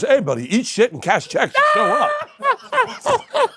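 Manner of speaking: high, slow, laughing shout; mock shouted advice. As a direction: bark it out like a tough dad, half laughing. Dad's blunt advice retold.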